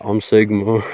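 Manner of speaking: normal talking voice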